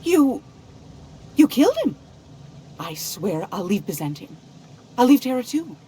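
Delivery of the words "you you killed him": louder, stunned horror; shocked and scared. Acted. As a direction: gasp it out, horrified. Shock at second killing.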